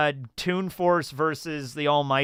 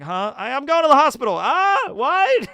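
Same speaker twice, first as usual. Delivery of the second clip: higher, louder half-crying confusion; jolted awake and lost; groggy confused waking; 12 semitones higher, 6 dB louder than usual. Retold or imitated. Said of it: "Like, it's like".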